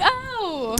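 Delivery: high, soft dawning oh; slow realization. Retold or imitated. Girl finally getting someone's joke, high and soft. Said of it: "And I'm like".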